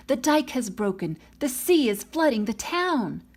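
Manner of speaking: rising, urgent cry of alarm; alarmed villagers. Acted. Villagers running down the road warn of the flood, alarmed shout. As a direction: shout the warning as panic climbs.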